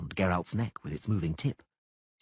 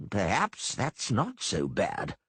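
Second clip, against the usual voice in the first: musing aloud; much slower than usual. Acted. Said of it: "Gillenstiern wondered".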